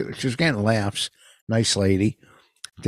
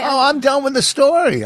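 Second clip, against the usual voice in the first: loud, high-pitched protest; exasperated outburst; 12 semitones higher, 6 dB louder than usual. Real, not acted. Storyteller interrupted once too often, loudly exasperated. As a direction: throw up the story in a raised voice.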